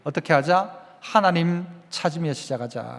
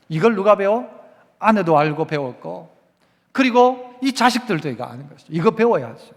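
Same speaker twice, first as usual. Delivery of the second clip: ordinary delivery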